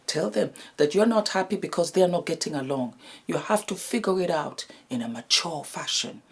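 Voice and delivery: hushed, as if sharing a secret; whispering. Real, not acted. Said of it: "Why am I whispering?".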